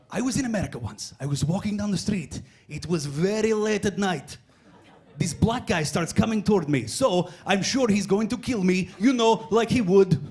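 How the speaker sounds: slow, measured mock Croatian accent; Croatian storyteller. Croatian tells an American a story, slowly, in a mock accent. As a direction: draw the tale out in a put-on Croatian accent. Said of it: "they wanna tell you this story".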